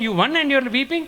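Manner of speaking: a mother who cannot believe it; shocked mother. Acted. Mother Kausalya voiced, shocked.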